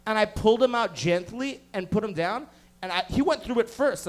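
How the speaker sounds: ordinary talk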